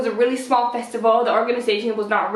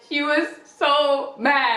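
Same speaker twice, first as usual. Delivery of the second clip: slow emphatic; 4 semitones higher, much slower than usual. Real, not acted.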